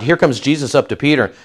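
ordinary talk, nothing marked

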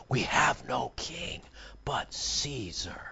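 a soft, slow whisper; hushed whisper. A narrator's reading. Preacher reading the chief priests' reply in a whisper. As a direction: breathe it out slowly, barely voiced.

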